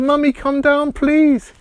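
put-on a child begging mum to come; pleading call. Timmy pleads to his mother.

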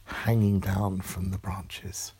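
ordinary delivery, nothing marked

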